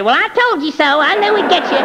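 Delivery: high-pitched imitation of a gloating wife; put-on high, taunting voice; mock nagging wife. Mimics nagging wife's unspoken gloat.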